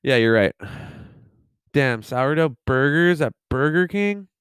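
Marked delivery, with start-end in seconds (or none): none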